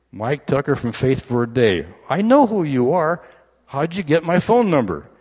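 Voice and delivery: high-pitched, loud disbelief; can't believe who's calling; incredulous. Retold or imitated. Woken husband amazed at caller.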